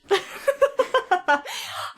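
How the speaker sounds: sudden high real laugh; high burst of laughter. Real, not acted.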